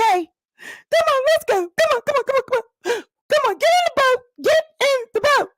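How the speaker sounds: frantic urging. Acted. Rushing wife onto the boat, frantic high calls. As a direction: hurry them onto the boat in a panic.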